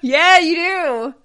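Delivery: slow laughing words. Real, not acted. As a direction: laugh through it. Laughing reply.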